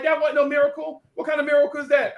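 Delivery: put-on high, scornful teen girl; high scoff. Lisa scoffing at his miracle, high pitched. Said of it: "She was like".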